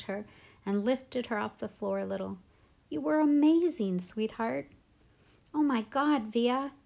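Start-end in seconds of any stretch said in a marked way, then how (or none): 2.9-4.7 high, loud, gushing compliment; excited praise. A narrator's reading. Dad hugging daughter after the play, excited praise. 5.5-6.7 higher, thrilled exclamation, short of a scream; mildly screamed excitement. A narrator's reading. Mom to daughter after the play, mildly screamed excitement. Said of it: "Mom was screaming with excitement".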